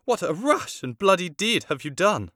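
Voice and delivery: high, loud aghast outcry; queen horrified at her son. Acted. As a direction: cry out in horror at the bloody deed. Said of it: "exclaimed the queen".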